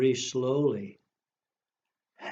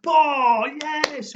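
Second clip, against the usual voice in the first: Paul and yes much higher and louder; triumphant; 14 semitones higher, 8 dB louder than usual. Acted. Librarian celebrating getting the rhyme right, higher and louder. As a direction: cheer the right answer. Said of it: "we got it right this time".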